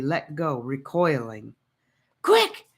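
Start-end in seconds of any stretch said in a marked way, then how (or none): 2.2-2.7 high, loud urgent command; urgent call. Acted. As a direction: hurry them into the crack. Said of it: "Gabe urged them".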